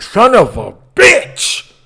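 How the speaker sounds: slow, drawn-out cry of pain; pained curse. Real, not acted. Cursing in pain as the medicine burns. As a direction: let the curse stretch with pain.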